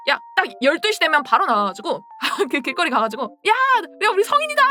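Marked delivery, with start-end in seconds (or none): 3.5-4.6 high excited yell to friends on the street; newly twenty, yelling to friends at midnight, high and loud; excited shout. Retold or imitated. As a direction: shout it out like a thrilled new adult. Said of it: "이러면서 막".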